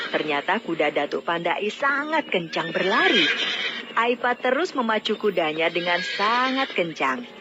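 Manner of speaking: ordinary delivery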